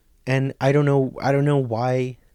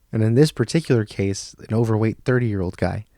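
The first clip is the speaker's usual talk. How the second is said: ordinary talk, nothing marked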